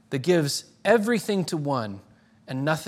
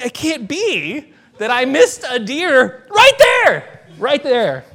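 louder and higher, slow, stunned disbelief; incredulous; 9 semitones higher, 11 dB louder, slower than usual. Retold or imitated. Hunter recalling the missed buck under his tree, loud stunned disbelief. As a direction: relive staring at the clean arrow, unable to believe it. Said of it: "I felt incredulous".

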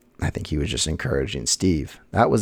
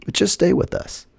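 encouraging; 11 semitones higher than usual. Retold or imitated. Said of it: "He's like".

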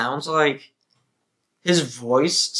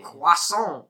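higher cartoon French; French impression; 5 semitones higher than usual. Continuing his French impression, cartoon French. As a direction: say it like a stage Frenchman. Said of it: "You wanna hear my French?".

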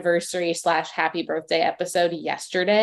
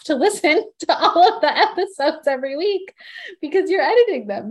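laughing; 6 semitones higher, 4 dB louder, faster than usual. Real, not acted. Laughing while praising the host.

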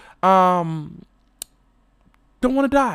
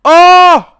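much higher, louder oh; ecstatic oh; 11 semitones higher, 13 dB louder than usual. Real, not acted. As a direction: cry out in remembered bliss. Ecstatic recall.

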